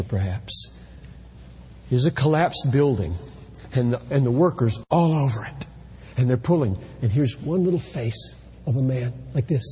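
usual speaking voice

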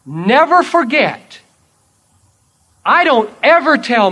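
loud, slowed, emphatic sermon point; emphatic preaching. Acted. Preacher warning the congregation, loud and emphatic. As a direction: hammer the lesson home. Preacher drives home lesson.

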